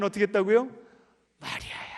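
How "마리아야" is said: 마리아야 whispered, soft and aching; longing whisper. Shown as a demonstration. Preacher shows Jesus calling Mary with aching tenderness, whispered. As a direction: breathe the name with longing. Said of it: "안타까움이 묻어나오게".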